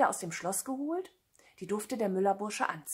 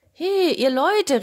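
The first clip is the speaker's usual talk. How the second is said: high, loud, stretched hail; calling out; 9 semitones higher, 9 dB louder, slower than usual. A narrator's reading. Cat hails the people in the cornfield, calling out. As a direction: holler slowly to people far away. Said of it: "rief er".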